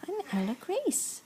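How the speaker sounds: put-on high little baby voice.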